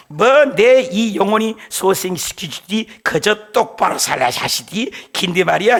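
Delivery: preacher entertaining the congregation, Pyongyang dialect. Pretend. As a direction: speak it like an old Pyongyang man, in Pyongyang dialect. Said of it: "평양도 사투리도 해볼게요".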